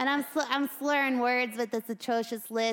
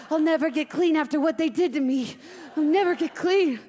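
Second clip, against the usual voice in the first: higher, building hysteria; traumatised panic; 6 semitones higher, 6 dB louder than usual. Acted. Hamster haunted by the children's hands, mounting panic. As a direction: repeat it obsessively, climbing in pitch.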